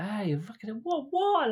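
high, squeaky pretend astonishment; retold shocked exclamation, voice jumping up; mock startled greeting. Retelling his surprised greeting.

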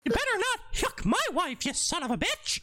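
very high, angry cartoon mouse; Mickey Mouse. Mouse character warning Goofy, high angry voice. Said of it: "a conversation between Goofy and fucking Mickey".

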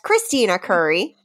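ordinary delivery

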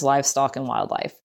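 ordinary talk

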